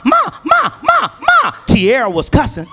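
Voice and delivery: put-on high shrill child calling ma; calling out as a kid. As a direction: yell for mom like an eager little snitch. Nephew snitching, calling mom.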